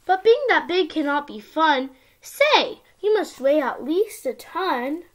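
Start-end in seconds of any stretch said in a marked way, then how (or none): none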